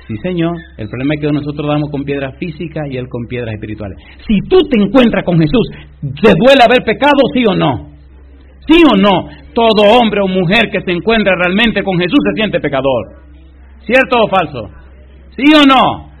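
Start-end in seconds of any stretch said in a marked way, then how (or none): 4.3-16.1 loud, high fervent preacher calls; shouting preacher demanding an answer; preaching at full force. Acted. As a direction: rouse the congregation with questions.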